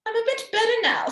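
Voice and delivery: high, loud put-on granny; wolf playing a husky granny. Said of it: "in a husky voice".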